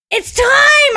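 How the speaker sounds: sudden high shout; battle-cry yell. Retold or imitated. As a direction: shout it out to rally the kids. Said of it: "I'm, like, yelling at the kids".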